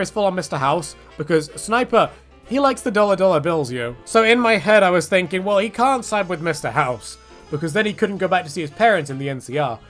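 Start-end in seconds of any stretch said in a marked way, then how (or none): none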